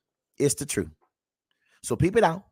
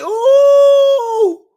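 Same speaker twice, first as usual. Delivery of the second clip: long high mock ooh; a sustained high ooh like a studio crowd; imitating the crowd; 10 dB louder than usual. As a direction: copy the audience's teasing ooh. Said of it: "Why they".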